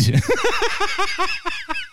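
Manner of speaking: high, sudden burst of real laughter. Real, not acted. Host cracking up at the translated chorus, real laughter. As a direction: crack up at the grim lyric.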